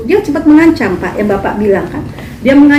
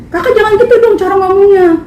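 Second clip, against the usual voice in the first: whiny friend; 9 semitones higher than usual. Retold or imitated. Quoting friend's complaint.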